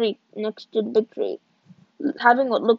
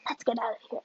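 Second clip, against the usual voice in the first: softer hushed repeat; whispered; 9 dB quieter, faster than usual. A narrator's reading. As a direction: hush it urgently so no one hears. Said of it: "I whispered".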